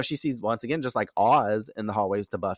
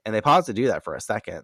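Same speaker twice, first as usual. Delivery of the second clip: normal talking voice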